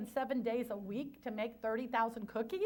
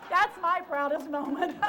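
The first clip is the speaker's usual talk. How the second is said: louder, spoken through laughter; laughing; 4 semitones higher, 8 dB louder than usual. Real, not acted. Speaker tells the audience her proudest moment while laughing. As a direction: push the words out while still laughing.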